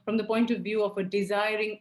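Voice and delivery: normal talking voice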